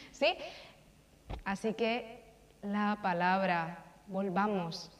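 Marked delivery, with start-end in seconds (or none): none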